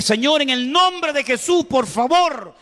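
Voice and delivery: high, slow, pleading prayer; urgent prayer. Retold or imitated. Father prays over his unconscious daughter, high and pleading. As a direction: beg God slowly and earnestly.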